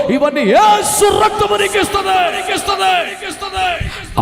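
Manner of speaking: thundering revival preaching; preaching at full force. Acted. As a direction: drive the litany to its climax. Sermon build to climax.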